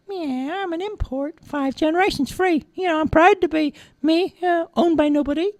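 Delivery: high-pitched cartoon cat; proud cat. A narrator's reading. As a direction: boast calmly as a little free-spirited cat.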